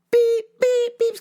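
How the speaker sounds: high, loud beeping; imitated reverse beeping. Vlogger mimicking the car's reverse sensor, high loud beeps.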